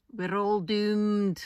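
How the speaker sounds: slow, loud Scottish we're all doomed; Frazer's Scottish doom. Retold or imitated. Vlogger quoting Private Frazer from Dad's Army, slow Scottish gloom. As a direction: moan it like the doom-laden Scot. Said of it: "in his accent and in the way he says it".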